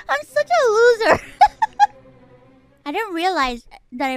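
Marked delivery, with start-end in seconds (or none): 0.0-2.9 laughing. Real, not acted. Laughing at own mishap.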